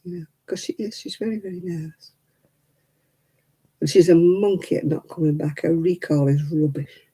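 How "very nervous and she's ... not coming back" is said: normal talking voice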